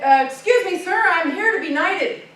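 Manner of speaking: loud, high, eager announcement; an overeager boy interrupting; eager blurt. A narrator's reading. Eager youth to king.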